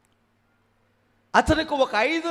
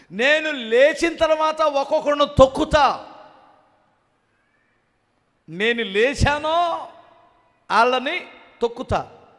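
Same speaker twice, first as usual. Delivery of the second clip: louder, higher, swaggering threat; mock vengeful boast; 4 semitones higher, 6 dB louder than usual. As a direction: ham up the revenge talk of a proud man. Mocking a vengeful believer's boast.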